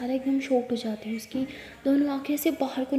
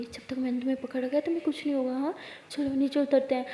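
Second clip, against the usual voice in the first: much softer, gentle hush; reassuring; 6 dB quieter than usual. Acted. Sara holds Jess's hands and reassures her, soft. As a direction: hold her hands and calm her.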